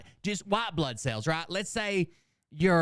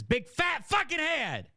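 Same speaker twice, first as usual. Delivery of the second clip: mocking shout; 12 semitones higher, slower than usual. Real, not acted. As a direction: shout it out in disbelief. Mocking Boogie's liar tattoo.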